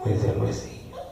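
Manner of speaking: teasing whisper of a name, half-laughing; mocking whisper. Old boss mocking the preacher by name, whispered. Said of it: "y se reía".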